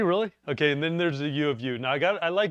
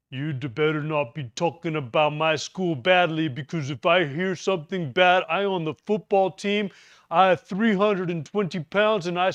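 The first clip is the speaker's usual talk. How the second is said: huge football player threatening; 6 semitones higher, 6 dB louder than usual. Retold or imitated. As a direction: puff up like a giant lineman and squash him. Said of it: "And the guy goes like this".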